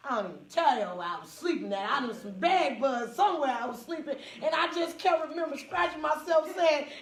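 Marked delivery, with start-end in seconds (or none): none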